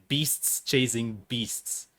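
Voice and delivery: drawn-out, careful word by word; laboriously slow. Retold or imitated. Guest quoting the actor's tongue-twister outtake, very slowly. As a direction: stretch out each tricky word. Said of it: "hours to say those three words".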